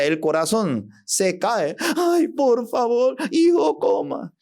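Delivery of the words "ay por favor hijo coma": high, shouted mother's plea; desperate mother. Acted. Frantic mother shouting at her son to eat, pitched high.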